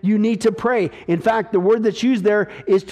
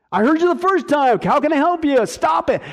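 exasperated God; 6 semitones higher than usual. Acted.

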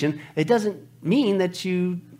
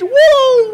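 very high, loud "woo"; hammed-up swooning whoop; excited whoop; 11 dB louder than usual. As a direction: let out a piercing cheer. Whoops over the man described.

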